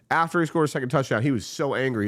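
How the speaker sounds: normal talking voice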